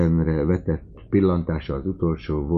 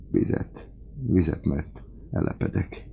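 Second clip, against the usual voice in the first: voiceless, faint begging; dying man whispering for water; parched whisper; 2 semitones higher, 4 dB quieter than usual. Acted. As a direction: rasp it out with no voice left.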